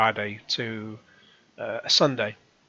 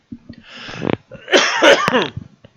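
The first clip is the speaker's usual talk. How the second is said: loud hacking cough; coughing fit; 12 semitones higher, 7 dB louder than usual. Real, not acted. Vlogger losing his voice mid-story, loud cough. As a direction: cough hard to clear a failing throat. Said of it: "my voice is going".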